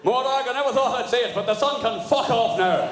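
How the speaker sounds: Moragに向けて高く大きく叫ぶ; a loud, fed-up Scottish plea; fed-up shout. Acted. Glaswegian voice calling to Morag.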